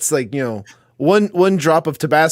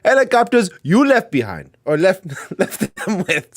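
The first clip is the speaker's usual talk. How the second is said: put-on reading in a light African accent; a hint of an African accent; 3 semitones higher than usual. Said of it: "we have to do an African accent".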